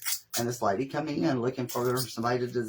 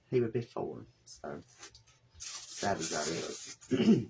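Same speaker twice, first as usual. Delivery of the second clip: subdued sadness; 6 semitones lower, 6 dB quieter, much slower than usual. Real, not acted. Wreath for a child's grave.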